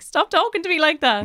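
mock protest. Friends protest at her teacher voice, imitated indignation. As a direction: object as if being talked down to.

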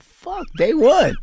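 very high, drawn-out lament; dismayed outburst. Retold or imitated. Actor reacting to his first day on set, dismayed. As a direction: let it squeal up in comic despair.

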